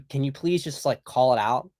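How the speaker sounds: ordinary talk, nothing marked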